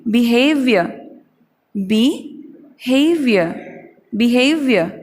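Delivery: बिहेवियर said as bi-hev-ya, drawn out; slow careful pronunciation. Shown as a demonstration. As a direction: spell out बिहेवियर syllable by syllable, very slowly. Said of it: "आइंदा से हम लोग बोलेंगे".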